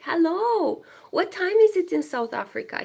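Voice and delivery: bubbly greeting to a far viewer; excited hello. Real, not acted. Excitedly greeting a viewer from South Africa.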